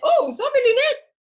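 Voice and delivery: sudden high oh of surprise; startled, looking for something; surprised. Real, not acted. Teacher can't find her glasses.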